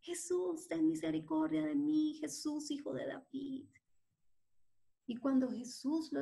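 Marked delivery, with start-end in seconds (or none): 0.0-3.7 a blind man straining to reach Jesus; calling from the roadside. Acted. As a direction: beggar calling out to Jesus from the road. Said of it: "Bartimeo desde el camino".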